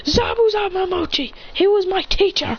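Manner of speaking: high-pitched grieving shout; acted grief and outrage; anguished cry. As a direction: cry out your dead teacher's name. Grieving student answers killers.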